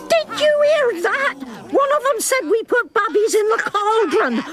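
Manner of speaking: high-pitched scandalised indignation; outraged. Acted. Witch in audience hears herself slandered, outraged. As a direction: cry out in affronted disbelief.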